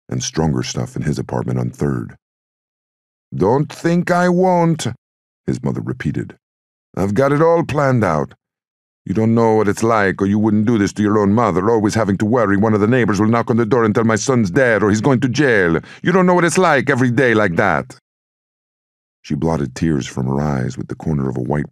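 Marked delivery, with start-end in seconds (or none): 3.3-4.9 very high, slow, stretched-out threat; distraught mother. A narrator's reading. Mother repeating her threat to Luca, distraught. 7.0-18.0 high, insistent ranting mother; distraught mother. A narrator's reading. Mother berating her son at the dinner table, distraught.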